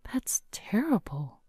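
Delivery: whispered in quiet dismay; shocked whisper. Acted. Wife to husband about girls, whispered in shock. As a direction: breathe it out, stunned.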